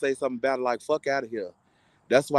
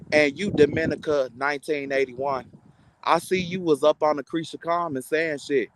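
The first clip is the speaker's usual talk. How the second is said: usual speaking voice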